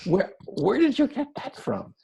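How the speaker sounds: high, incredulous squeak of disbelief. Retold or imitated. Telling the Austrian woman he can't believe it, incredulous. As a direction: sound floored by what she just said. Retelling his shocked reaction.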